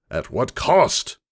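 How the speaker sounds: slow, loud furious shout. A narrator's reading. Lord Hex shouting at the noble over cost. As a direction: hurl the question at him, slowly. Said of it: "shouted Lord Hex".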